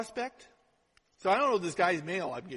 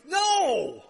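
emphatic no; 6 semitones higher, 5 dB louder than usual. Real, not acted. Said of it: "rebuts her own worry".

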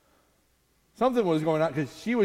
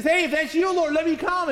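eager call out; 8 semitones higher than usual. Retold or imitated. Peter calls out to Jesus.

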